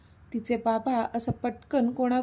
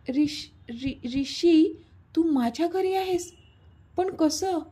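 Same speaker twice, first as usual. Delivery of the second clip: stuttering his name, then crying out higher; stammering shout; 5 semitones higher, slower than usual. Acted. She discovers he is at her home, stammering then shouting. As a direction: blurt it out in stunned alarm. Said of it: "ती अक्षरशः ओरडलीच".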